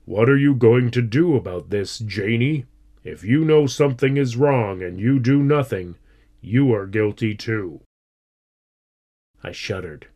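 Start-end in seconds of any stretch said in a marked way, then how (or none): 0.0-7.8 lightly voiced stern father; a touch of severe parental warning. A narrator's reading. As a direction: lay down the law firmly. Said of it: "I could hear his stern voice saying".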